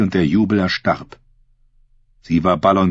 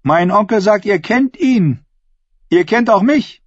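high, projected oratory; ringing, high-pitched public speech; bold woman addressing assembly; 13 semitones higher, 4 dB louder than usual. A narrator's reading. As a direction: claim the crowd as your own.

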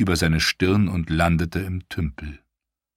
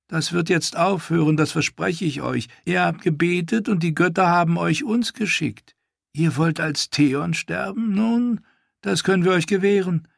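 cruel mock pity; 12 semitones higher than usual. Ash taunting Theon with false pity, high cooing. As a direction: coo false sympathy at him.

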